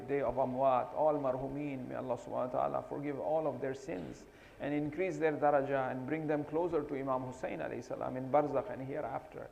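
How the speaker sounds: ordinary talk, nothing marked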